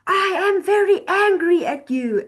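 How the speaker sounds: high, loud shout. Shown as a demonstration. Teacher shows how a text can read as shouting. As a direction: yell it as if the text were screamed. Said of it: "and shouting at you".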